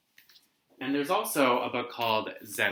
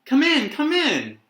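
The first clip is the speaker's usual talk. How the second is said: high, loud calling from a distance; faraway call; 9 dB louder than usual. Acted. Stillwater calling Addie in from the backyard, faraway voice. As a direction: call it from far off. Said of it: "a faraway voice called".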